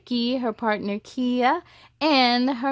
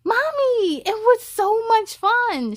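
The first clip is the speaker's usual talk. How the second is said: put-on little girl bursting with excitement, higher; excited child; 10 semitones higher, 6 dB louder than usual. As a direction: squeal it happily to mommy. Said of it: "she replied, excited".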